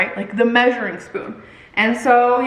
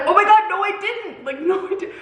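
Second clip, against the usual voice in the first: high-pitched flustered denial; mock protest; 8 semitones higher than usual. Creator denies it to commenters, retold flustered protest. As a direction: burst out high, then drop the volume. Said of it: "I was like".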